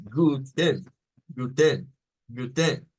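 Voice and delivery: "guten" with a syllabic n, the e swallowed; German guten. Shown as a demonstration. Teacher modelling the correct German guten for students. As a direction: say "guten" slowly, no vowel before the n. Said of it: "Es como decirles, mire".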